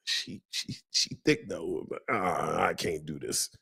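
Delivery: soft, low, giving-up whisper; defeated whisper. Retold or imitated. Whispering to himself, torn about the woman. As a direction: mutter it as you talk yourself out of it.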